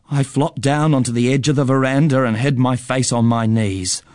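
plain reading voice

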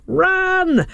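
high yelled warning; urgent shout. Retold or imitated. Quoting the spooky answer phone.

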